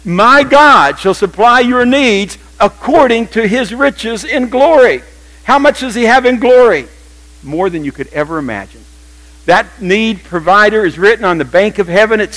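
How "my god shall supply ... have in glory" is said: loud, high, slow proclamation of the verse; full-force preaching. Real, not acted. Preacher to congregation, proclaiming the verse at full force. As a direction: preach it out, every word weighed.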